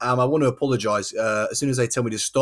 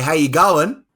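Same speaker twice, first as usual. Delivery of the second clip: put-on Australian "how you going", rising at the end; improved Australian greeting; 3 dB louder, slower than usual. Host copying the guest's greeting, mock Australian accent. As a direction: try the Australian greeting properly. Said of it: "That's good, that's better".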